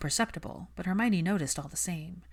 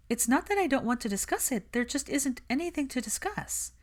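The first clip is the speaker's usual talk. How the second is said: put-on lightly raised, brisk young woman; Hermione's voice; 9 semitones higher than usual. Hermione brushing off Malfoy, cool and defensive. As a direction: keep her cool and a little defensive.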